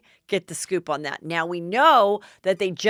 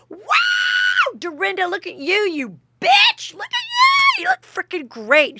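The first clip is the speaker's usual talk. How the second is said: put-on shrieking, very high drunk compliment; drunk squeal; 6 dB louder than usual. Leah drunkenly praising Dorinda's outfit, high shrieking squeal. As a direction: shriek with tipsy delight. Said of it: "and she's like".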